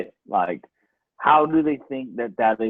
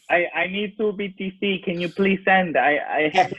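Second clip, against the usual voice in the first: Indian scammer imitation; 6 semitones higher than usual. Indian scammer asking Andrew to send two BTC, in a lightly higher Indian accent. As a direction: play the Indian scammer begging for Bitcoin. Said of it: "guy from India pretending to be me".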